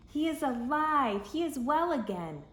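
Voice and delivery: loud, high joyful news; joyful shout. Acted. Servants announce joyful news.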